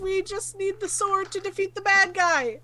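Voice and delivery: high-pitched, earnest plea; pleading. Acted. Player explaining themselves to the booming spirit, pleading.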